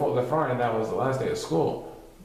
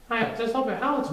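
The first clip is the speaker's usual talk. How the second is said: put-on child voice; 10 semitones higher than usual. Child voiced in read story.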